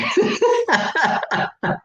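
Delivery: a burst of high helpless laughter; real laughing. Real, not acted. Host laughing at his own innuendo to viewers, high giggles. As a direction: crack up at your own joke. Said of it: "I'm sorry I'm laughing".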